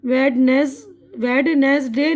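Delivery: "वेडनेसडे" slowly as wed-ness-day; silent D sounded. Shown as a demonstration. Teacher showing the wrong Wednesday, D sounded. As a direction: spell out "वेडनेसडे" by sound, first D heard. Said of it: "नहीं है".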